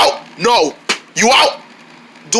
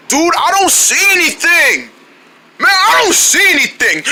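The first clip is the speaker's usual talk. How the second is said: rising impatience; 7 semitones higher than usual. Pretend. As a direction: insist you see nothing. Searching, growing impatient.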